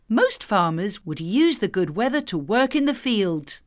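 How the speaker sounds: ordinary delivery, nothing marked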